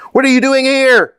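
loud, very high-pitched demand; angry roar. A narrator's reading. Armed rider confronts campers, roaring angrily. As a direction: roar it at intruders. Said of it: "roared one man".

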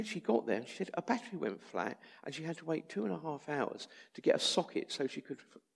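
ordinary delivery

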